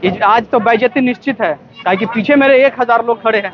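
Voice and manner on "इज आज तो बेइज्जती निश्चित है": ordinary delivery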